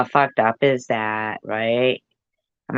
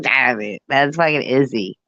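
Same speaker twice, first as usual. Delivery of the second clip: ordinary talk, nothing marked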